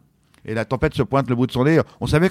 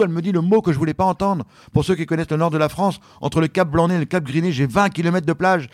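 usual speaking voice

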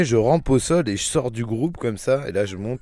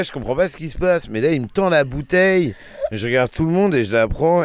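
usual speaking voice

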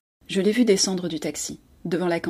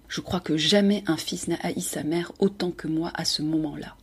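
usual speaking voice

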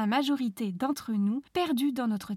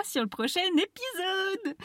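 playful high voice; 8 semitones higher than usual. Pretend.

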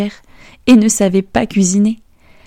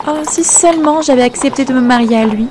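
higher, wistful exclamation; exclaiming; 5 semitones higher than usual. Acted. Princess regretting the king, higher exclamation. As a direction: cry out what she missed. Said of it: "s'exclama la princesse".

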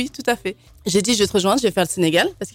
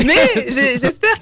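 laughing; 6 semitones higher, 5 dB louder, much slower than usual. Real, not acted. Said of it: "laughing at her own accent".

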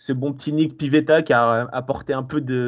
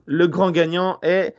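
slow, drawn-out winner reveal, pitched up; booming prize announcer; 4 semitones higher, slower than usual. Shown as a demonstration. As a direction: announce the winner like a game show host. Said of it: "roulement de tambour".

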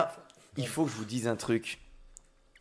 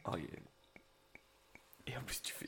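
whisper; 3 semitones higher, 18 dB quieter, much slower than usual. Real, not acted. Whispered aside while drinking.

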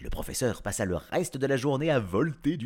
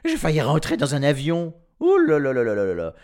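loud, higher panicky exclamation; alarmed; 5 semitones higher, 8 dB louder, faster than usual. A narrator's reading. Professor nearly hitting a plane, alarmed. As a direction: blurt it loudly, tumbling into a rapid lament.

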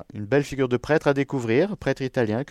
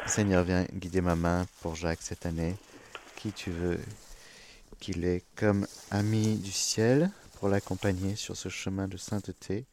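softer, hushed prayer to the Lord; quiet prayer; 4 semitones lower, 7 dB quieter than usual. Acted. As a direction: pray it gently, almost to yourself. Prayer before drawing a saint. (prière douce et recueillie)

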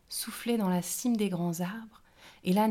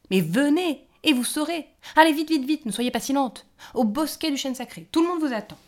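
much higher and louder, bustling gnome; excited hurry; 7 semitones higher, 10 dB louder than usual. A narrator's reading. The gnome hurries the fairies to the sacred grove, loud and excited. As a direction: rush them out the door.

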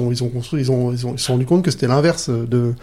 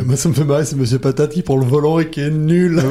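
ordinary talk, nothing marked

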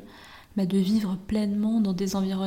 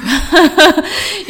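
loud, high peal of laughter; big laugh; 7 semitones higher, 14 dB louder than usual. Real, not acted. Traveller recalls a table-for-ten mix-up, big burst of laughter. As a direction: crack up loudly.

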